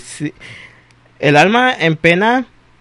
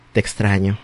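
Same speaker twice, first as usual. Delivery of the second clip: low, soft admission; hushed, low-pitched longing; lovesick confession; 7 semitones lower, 7 dB quieter than usual. Acted. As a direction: confess it quietly to an ex on the phone. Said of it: "Oye, ¿te puedo decir algo?".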